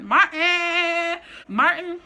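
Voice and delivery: loud, high "Martin!"; excited announcement. Real, not acted. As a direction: call it out like a big reveal. Hyping the number one show.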